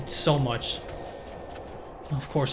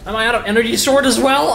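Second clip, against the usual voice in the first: usual speaking voice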